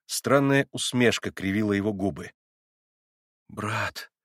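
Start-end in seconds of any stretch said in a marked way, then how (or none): 3.5-4.1 trembling voice. A narrator's reading. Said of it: "дрожащим голосом начал опять Алёша".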